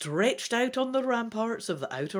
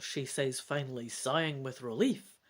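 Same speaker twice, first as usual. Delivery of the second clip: ordinary delivery, nothing marked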